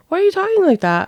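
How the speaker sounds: exasperated mom. Retold or imitated. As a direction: ask it again, fed up and quick. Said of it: "And I was like".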